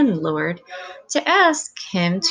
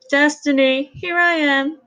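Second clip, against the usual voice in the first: high, louder call across to the neighbour's stoop; 7 semitones higher, 4 dB louder than usual. Acted. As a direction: call out to Destiny from next door. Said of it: "Missus Wade called from her stoop".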